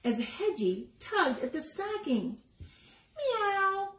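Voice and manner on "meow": high called-down meow; cat meow. Acted. Barn cat calls down from the tree, high meow. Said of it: "he called down".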